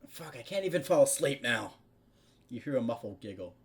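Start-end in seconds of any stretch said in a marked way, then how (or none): 0.1-1.8 frustrated. Acted. Frustrated character can't sleep.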